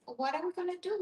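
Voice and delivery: ordinary talk, nothing marked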